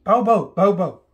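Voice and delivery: loud playful woof woof; barking dog. Pretend. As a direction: bark it out like a puppy. Parent voices dog for baby.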